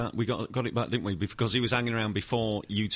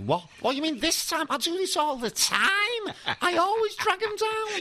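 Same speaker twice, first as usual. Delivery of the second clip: mocking impression. Mocking a defender's denial.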